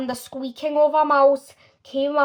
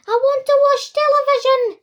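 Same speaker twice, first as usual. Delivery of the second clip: much higher, louder squealing demand; squeaky tantrum; 12 semitones higher, 6 dB louder than usual. Acted. Shrunken boy throws a tantrum in his mother's palm, squealing. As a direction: scream it like a tiny furious child. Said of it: "he squeaked".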